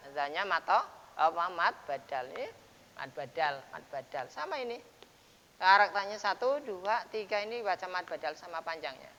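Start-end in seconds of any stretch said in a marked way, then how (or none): none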